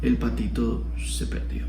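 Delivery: low, soft, mournful; sad. Shown as a demonstration. Teacher demos a grieving take, low and soft. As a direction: let the loss weigh on every word. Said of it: "a decir".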